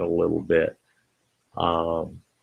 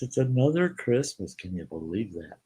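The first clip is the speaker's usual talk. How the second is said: ordinary talk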